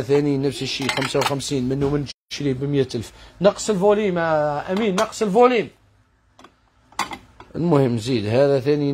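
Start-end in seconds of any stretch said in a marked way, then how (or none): 3.4-5.7 raised, higher-pitched call to a helper; higher voice, insistent call. Real, not acted. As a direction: call out an order across to someone. Calls to helper to lower volume.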